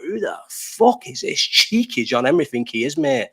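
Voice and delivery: slow, affronted owner voice, lightly put on, ending high on mate; a hint of an outraged rich owner; mock indignant club owner. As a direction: sneer at the cheeky rival as the owner. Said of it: "But he thought".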